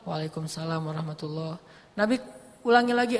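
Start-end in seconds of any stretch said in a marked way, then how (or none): 0.1-1.6 answering the greeting barely above a breath; reply so softly he cannot hear; hushed reply. Retold or imitated. Companion answers the Prophet's salam very softly on purpose. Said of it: "saya pelankan suara".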